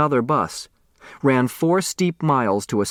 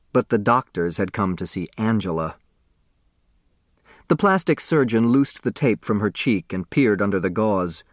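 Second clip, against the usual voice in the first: usual speaking voice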